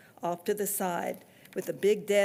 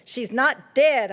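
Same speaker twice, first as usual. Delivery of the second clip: loud, slow, higher insistence; girl insisting to the driver; slow yell; 5 semitones higher, 8 dB louder, slower than usual. A narrator's reading. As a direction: drag it out loudly. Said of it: "I started yelling again".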